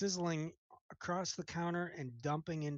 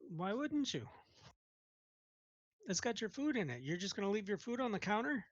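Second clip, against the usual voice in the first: higher, mock-baffled; puzzled cook; 8 semitones higher than usual. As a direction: act surprised at the question. GM answers in cook voice.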